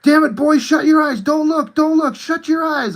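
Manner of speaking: high, frantic dad voice; urgent, high-pitched parental order; panicked dad impression. Son imitating dad ordering him not to look, high frantic voice. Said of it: "My dad's like".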